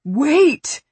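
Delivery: high, loud cry of alarm; fearful shout. A narrator's reading. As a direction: shout it in sudden fear. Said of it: "called out in instinctive fear".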